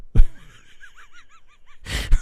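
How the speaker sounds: helpless high laugh; high loud burst of laughter. Real, not acted. Vlogger signing off, bursting into laughter. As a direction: crack up helplessly. Cracking up at the end.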